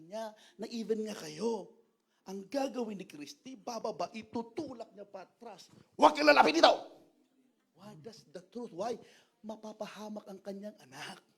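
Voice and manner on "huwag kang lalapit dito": put-on loud, sharp yell at the child to stay back; mother yelling. Mother shouting at her child to stay away, loud warning. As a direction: snap a warning from above, shouting.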